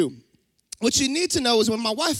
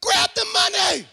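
mock urgent command; 7 semitones higher than usual. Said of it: "And she goes".

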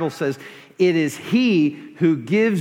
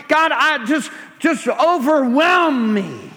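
higher and louder, pleading passionately to heaven; fervent crying-out prayer; 7 semitones higher, 3 dB louder than usual. Shown as a demonstration. Pastor shows the congregation a prayer at full force, fervent and loud. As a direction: pour it out like a desperate cry to God. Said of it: "Is that your prayer?".

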